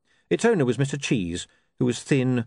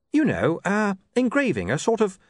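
higher, lightly put-on young man; William's voice; 10 semitones higher than usual. A narrator's reading. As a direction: play William higher and eager. William explaining printing eagerly.

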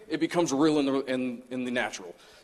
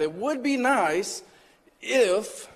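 much higher, slow and drawn out; mock pleading; 7 semitones higher, much slower than usual. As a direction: drag out a playful plea to God. Playful plea to God.

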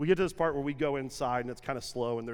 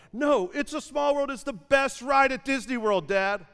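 put-on high, pleading child's whine; insistent kid; 9 semitones higher, 5 dB louder than usual. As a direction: beg like a stubborn five-year-old. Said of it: "throws a colossal fit".

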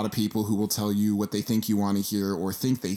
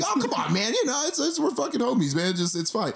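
friend reassuring; 12 semitones higher than usual. Retold or imitated. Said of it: "he's like".

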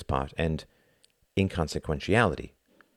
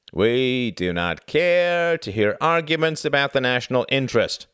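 drawl; 8 semitones higher, 4 dB louder than usual. Acted. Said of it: "drawled another".

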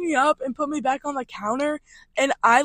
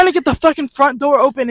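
normal talking voice

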